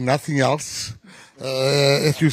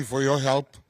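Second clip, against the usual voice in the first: ordinary talk, nothing marked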